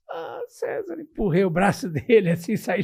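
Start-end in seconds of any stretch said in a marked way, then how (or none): 0.1-1.0 high, soft hurt cry; hurt whimper. Retold or imitated. Storyteller imitating his own hurt reply to César, high and soft. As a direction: say his name like you're about to cry. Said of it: "Eu fiz assim".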